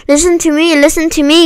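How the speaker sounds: high-pitched, restrained storybook scream; mild mock goose scream. A narrator's reading. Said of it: "screamed the goose".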